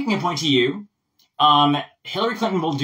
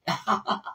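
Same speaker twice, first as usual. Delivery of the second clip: small soft giddy giggle; giddy laugh; 3 semitones higher, 7 dB quieter than usual. Acted. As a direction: let out a little put-on giggle. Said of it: "like, giddy laugh".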